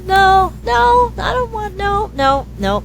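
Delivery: put-on high, whiny little girl saying no; little girl refusing.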